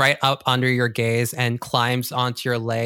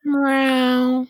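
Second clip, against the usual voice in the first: pitched-up loud cat meow; loud high meow; 10 semitones higher, 7 dB louder than usual. Acted. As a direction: yowl it like a cat.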